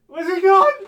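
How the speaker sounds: high, loud alarmed question; alarmed search. Real, not acted. Streamer losing her makeup tool mid-stream, high alarmed cry. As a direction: panic over a lost item.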